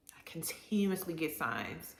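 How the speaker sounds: hushed, secretive whisper. Real, not acted. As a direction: breathe the words, barely voiced. Confiding about signs.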